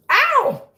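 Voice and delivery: high, loud "ow" of pain; pained yelp. Real, not acted. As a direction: yelp from a sudden nip. Kitten bites the finger.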